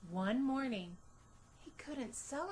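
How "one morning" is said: plain reading voice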